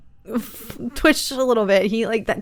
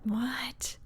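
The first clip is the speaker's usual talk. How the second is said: whisper; 2 semitones higher, 12 dB quieter than usual. Real, not acted. Whispered puzzling.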